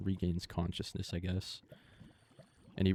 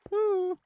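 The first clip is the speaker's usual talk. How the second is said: high relieved whoop; 9 dB louder than usual. Real, not acted. Relief after surviving.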